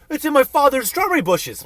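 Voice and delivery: indignant son. Retold or imitated. Son scolding about strawberries.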